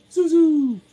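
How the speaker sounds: high, loud singsong call; calling the dog. Retold or imitated. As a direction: squeal the pet name out loud.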